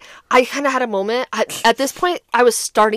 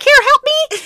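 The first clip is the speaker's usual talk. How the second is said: high-pitched, playful cry for help; mock call for help; 13 semitones higher, 4 dB louder than usual. Sarcastic friend mimicking waving for help, high mock plea. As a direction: call out in exaggerated helplessness.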